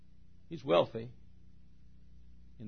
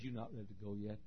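much softer, low and firm; quiet stern God; 5 semitones lower, 19 dB quieter than usual. Acted. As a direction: say it low, as a calm stern father. God speaking to Jacob.

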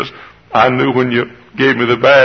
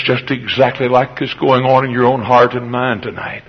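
normal talking voice

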